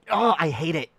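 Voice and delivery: usual speaking voice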